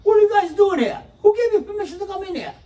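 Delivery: furious high shout; exploding waiter. Retold or imitated. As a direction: blow up at the family like an enraged waiter. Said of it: "And he explodes".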